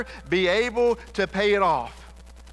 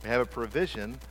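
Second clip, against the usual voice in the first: much softer, lower, intimate appeal to the room; soft earnest preaching; 5 semitones lower, 7 dB quieter than usual. Acted. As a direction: bring it down close, pleading gently. Soft appeal to congregation on forgiveness.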